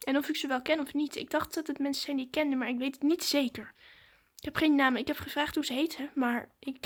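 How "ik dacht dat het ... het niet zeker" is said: ordinary delivery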